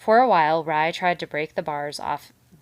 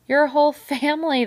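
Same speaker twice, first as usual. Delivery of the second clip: much higher, loud gleeful echo; delighted beaver; 10 semitones higher, 10 dB louder than usual. A narrator's reading. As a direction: relish the words with glee.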